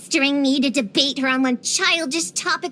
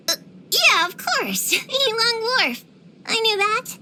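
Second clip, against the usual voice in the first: higher-pitched, covering a slip; bluffing; 6 semitones higher than usual. Acted. Claiming to know Yilong Wharf already, flustered bluff. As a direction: pretend you knew it all along.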